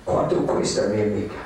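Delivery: put-on low, in Spanish with a Mexican accent; Spanish pickup line. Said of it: "That means, "How much, my lady?".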